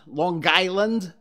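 slow, drawn-out Long Island accent on "Long Island"; "Long Island" in a mild local Long Island accent. Shown as a demonstration. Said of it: "as they say".

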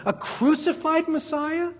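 very high-pitched disbelieving question; crowd's incredulity. Retold or imitated. Preacher voicing the crowd scoffing at a crucified Messiah, incredulous. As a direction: echo it back as if it were absurd. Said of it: "they're saying".

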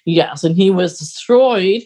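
normal talking voice

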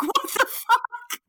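high, drawn-out retold shock; shocked disbelief. Retells shock at wrong lane.

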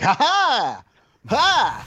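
high comic "ha" strike noises; silly-voiced fighter yelps. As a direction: yelp each hit in a funny high voice. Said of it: "like, a funny, silly voice".

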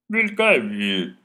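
loud, roaring wind spirit; booming wind voice. Acted. The wind roars back at the birches. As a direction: boom it out like a great wind. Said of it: "hördes en dånande susande fråga från vinden".

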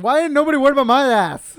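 high, very loud comic outcry; exaggerated yelled complaint; indignant mock shout. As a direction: holler it like an aggrieved boxer, hammed up. Acting out the boxer's indignant reaction.

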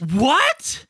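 high, rising shock, then drawn-out denial; mock movie disbelief. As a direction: parody a bad film performance. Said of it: "me going like this".

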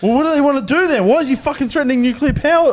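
high, loud exasperated protest; real alarm pushed high and loud; worked-up outburst. Real, not acted. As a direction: demand an answer, fired up and loud. Heated argument about nuclear threats.

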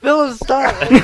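high laughing shout. Real, not acted. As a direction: yell it while cracking up. Laughing at Philip's look.

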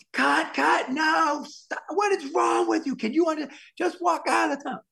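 very high, exasperated imitated shouting; screaming director. Director berates actor coming out of the tunnel, imitated screaming. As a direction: shout it like a director at the end of his rope. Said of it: "he's screaming over the thing".

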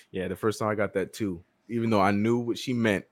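ordinary talk

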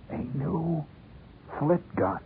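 high, soft, hoarse hush; dramatic hushed boy's confidence; hushed secret voice. A narrator's reading. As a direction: reveal a secret punchline under your breath. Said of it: "his voice dropping even lower".

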